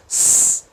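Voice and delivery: long "s" hiss without voice; isolated S sound. Shown as a demonstration. Teacher isolating the first sound, voiceless "s". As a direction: hold "s" with no vowel. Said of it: "som do S no começo".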